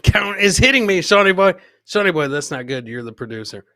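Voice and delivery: usual speaking voice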